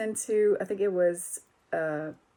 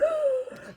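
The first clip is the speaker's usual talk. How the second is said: sharp high gasp with a laugh in it; amazed gasp; 3 dB quieter than usual. Retold or imitated. Singer reliving first hearing of heavy guitar, amazed gasp. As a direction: gasp like hearing something incredible. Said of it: "I was like".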